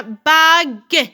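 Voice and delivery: "bague" drawn out in two beats; syllable by syllable. Shown as a demonstration. Teacher splitting a word for pupils, syllable by syllable. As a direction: stretch "bague" slowly, sounding the final e. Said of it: "si je frappe les syllabes".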